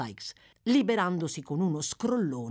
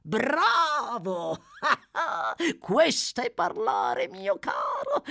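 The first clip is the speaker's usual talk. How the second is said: high, laughing approval; gleeful, drawn-out praise of a dear accomplice; exclaiming in delight; 7 semitones higher, 4 dB louder, much slower than usual. Acted. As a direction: cheer him on with a cackle. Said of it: "esclamò l'ebreo".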